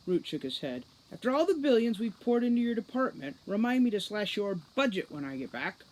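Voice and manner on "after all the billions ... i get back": exasperated threat. Acted. Root threatens Foley's budget.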